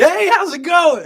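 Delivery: loud, very high mock greeting; hammed-up hello to someone just met; greeter voice. Shown as a demonstration. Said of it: "their greeter voice".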